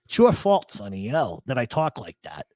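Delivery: ordinary talk, nothing marked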